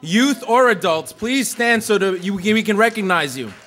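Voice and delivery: calling the whole room to stand. Real, not acted. Speaker calling educators in the audience to stand, loud.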